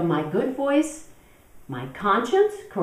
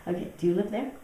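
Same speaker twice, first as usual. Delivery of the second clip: ordinary talk, nothing marked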